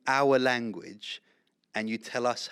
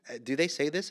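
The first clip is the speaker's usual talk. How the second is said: usual speaking voice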